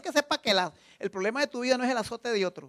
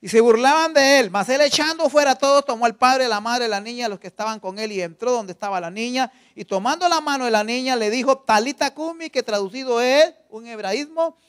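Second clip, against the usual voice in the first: louder, emphatic reading; preached scripture reading; 8 dB louder than usual. A narrator's reading. Preacher reading to the congregation, loud proclaiming. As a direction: proclaim the passage to the church.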